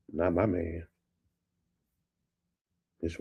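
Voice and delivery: normal talking voice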